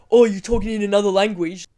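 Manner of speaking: a hint of a smug guard; mock guard voice. Guard quoted mocking brother.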